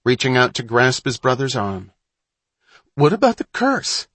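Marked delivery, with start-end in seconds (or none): 2.9-4.1 high, loud, frightened outburst; alarmed, much higher voice; panicked question. Acted. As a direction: blurt it out in fear. Frightened boy in the dark.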